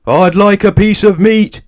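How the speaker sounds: loud, high shout. A narrator's reading. Mister Noisy shouts when the butcher ignores him. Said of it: "Mister Noisy shouted".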